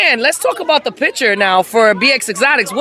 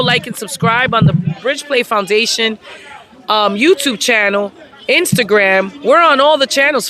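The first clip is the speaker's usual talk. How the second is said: ordinary talk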